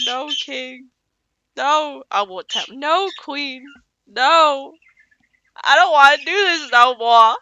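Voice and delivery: high, whining plea that climbs to loud; whiny high pleading. Retold or imitated. Pasha pleading with the king.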